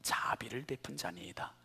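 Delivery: whispered, rising at the end; lawyer's reluctant answer, whispered; hushed quote. Retold or imitated. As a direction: breathe the lawyer's answer softly. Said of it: "그렇게 얘기하지 않았어요".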